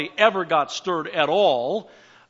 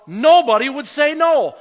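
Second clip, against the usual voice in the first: loud, high, hammered preaching line; forceful declaration; 7 semitones higher, 10 dB louder than usual. Real, not acted. Preacher insists to the congregation, loudly. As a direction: proclaim it to the back row.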